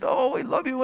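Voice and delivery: soft, high cooing to a newborn; a hint of baby talk; tender cooing. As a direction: coo softly to the baby.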